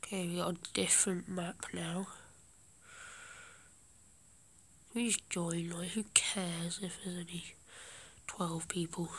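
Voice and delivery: hushed gaming commentary; whispering. Real, not acted. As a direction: keep it to a whisper. Holds whisper throughout.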